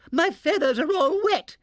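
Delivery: high, loud dismayed cry; cried out. A narrator's reading. Barnaby's mother soaked in the lake, high loud cry. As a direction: wail about the soaking. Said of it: "cried Barnaby's mother".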